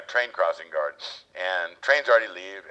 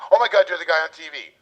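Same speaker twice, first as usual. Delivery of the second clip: excited fan; 8 semitones higher, 7 dB louder than usual. Retold or imitated. Lady recognizes him, excited.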